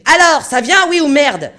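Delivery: hurlement furieux, très haut et très fort; vocifération. A narrator's reading. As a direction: aboie ton impatience depuis la glace. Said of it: "Olsen vociférait". (le capitaine vocifère depuis la glace)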